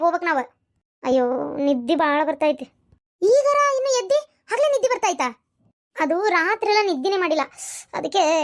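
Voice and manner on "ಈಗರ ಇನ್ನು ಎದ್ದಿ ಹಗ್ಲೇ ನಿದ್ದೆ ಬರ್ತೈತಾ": high-pitched disbelieving question; incredulous. Acted. Woman challenging a rival's sleepiness, incredulous.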